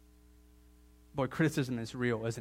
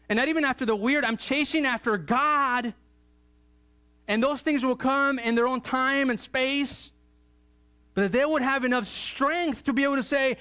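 full-force preaching; 10 semitones higher, 5 dB louder than usual. Real, not acted. Preacher urging families to chase after God, full-force preaching. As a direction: preach it loud and high, stretching key words.

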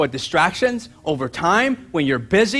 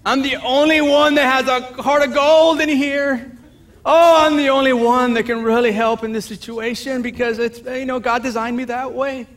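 mock self-pity; 6 semitones higher than usual. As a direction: ham up the lonely martyr.

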